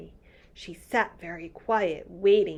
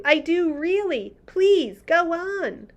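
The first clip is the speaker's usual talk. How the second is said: higher, urgent pleading; girl pleading with the giant; pleading cry; 10 semitones higher, 6 dB louder than usual. Acted. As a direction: beg him to keep going. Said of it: "Sophie cried".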